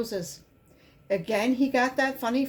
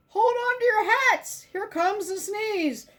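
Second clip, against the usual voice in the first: excited warning; 7 semitones higher than usual. A narrator's reading. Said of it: "yelled the doctor".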